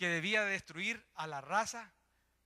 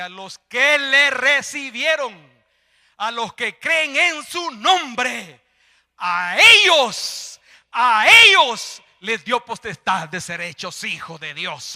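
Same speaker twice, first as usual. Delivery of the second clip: loud, drawn-out scripture proclamation; full-force preaching; 5 semitones higher, 20 dB louder, slower than usual. Real, not acted. Preacher quoting the Bible against infant baptism, full-force preaching. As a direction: stretch the key words at full volume.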